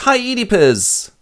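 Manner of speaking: high, loud cheery greeting; breezy hello to the king; Creon's voice. Acted. Creon greeting his brother-in-law, high and chirpy. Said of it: "So Creon enters and goes".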